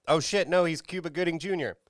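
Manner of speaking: ordinary delivery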